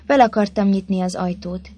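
usual speaking voice

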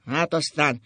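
gruff, dismissive grumble; grumbling. A narrator's reading. Drunk husband brushing off his wife's alarm, grumbling. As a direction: grumble it off without caring. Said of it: "dörmögött Ákos".

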